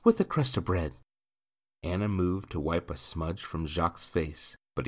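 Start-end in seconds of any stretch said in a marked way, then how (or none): 0.0-0.9 high, quick character voice; young woman in the story. Acted. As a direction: voice her higher and brisker than the narrator.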